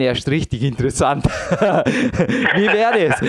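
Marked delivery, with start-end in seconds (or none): 1.3-2.5 laugh. Real, not acted. Laughs at own idea. 2.6-3.2 laughing. Real, not acted. Laughs at own idea.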